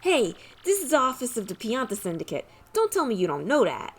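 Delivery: lightly voiced New York Italian wise guy; a thin Italian mafia accent, tough-guy swagger; reading Pianta lines in a light Italian mob accent. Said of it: "My Italian accent isn't great".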